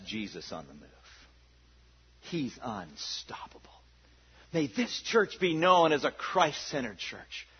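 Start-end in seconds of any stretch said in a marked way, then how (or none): 0.0-3.7 hushed whisper. Real, not acted. Awed aside after pastor story.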